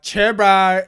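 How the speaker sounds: broad Kiwi accent, loud and high. Shown as a demonstration. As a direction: belt it out in a thick Kiwi accent. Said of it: "and Kiwi accent".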